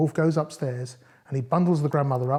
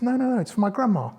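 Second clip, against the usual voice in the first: put-on high little girl refusing; high-voiced girl holding her ground; Little Red Rosenberg; 8 semitones higher, 4 dB louder than usual. A narrator's reading. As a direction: pitch it up as a protesting little girl.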